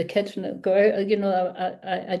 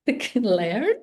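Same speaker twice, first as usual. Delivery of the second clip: laughing retort; 3 semitones higher, 5 dB louder, slower than usual. Retold or imitated. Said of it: "And I said".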